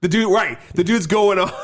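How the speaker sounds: ordinary talk, nothing marked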